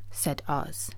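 ordinary delivery

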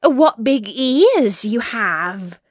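put-on slow, loud wonder of a little girl; marvel, drawing out the key word; amazed. A narrator's reading. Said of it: "She was greatly amazed".

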